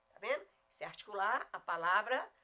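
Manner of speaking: ordinary delivery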